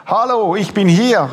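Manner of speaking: put-on a father shouting to his children outside the prison window; calling out loud. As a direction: call as loud as you can through bars. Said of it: "rief ich, so laut es ging".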